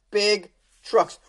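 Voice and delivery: ordinary delivery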